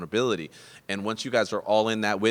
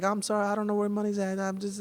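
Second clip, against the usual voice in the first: high-pitched mock crying apology; imitated tearful pleading; 11 semitones higher than usual. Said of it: "So she's crying".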